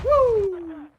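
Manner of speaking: high, loud whoop; excited whoop. Real, not acted. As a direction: cheer out loud. Excited at a gun.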